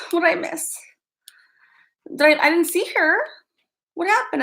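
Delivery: ordinary talk, nothing marked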